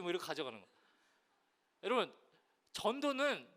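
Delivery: ordinary talk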